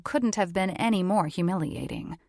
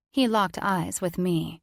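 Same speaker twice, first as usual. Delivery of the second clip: ordinary delivery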